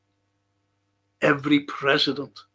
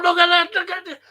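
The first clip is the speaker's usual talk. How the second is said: excited retold call; 13 semitones higher, 6 dB louder, faster than usual. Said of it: "And he was going".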